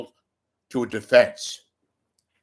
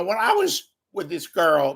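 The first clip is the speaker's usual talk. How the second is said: ordinary talk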